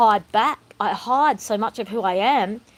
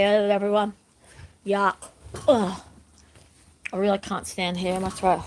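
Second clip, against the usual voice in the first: ordinary talk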